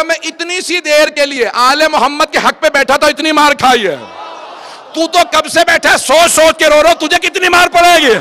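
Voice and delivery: high, loud, strained preaching shout building to the end; impassioned preaching climax. Acted. Bahlul warns the caliph of his punishment, shouting at full preaching force. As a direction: cry it out to the crowd, building to the peak.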